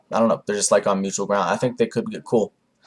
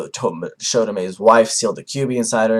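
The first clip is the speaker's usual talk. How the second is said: usual speaking voice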